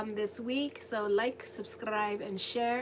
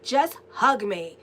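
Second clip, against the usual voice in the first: loud, slow, stubborn demand; angry demand; 9 dB louder, slower than usual. Retold or imitated. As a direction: snap it out loud like a grumpy kid. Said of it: "like, angrily".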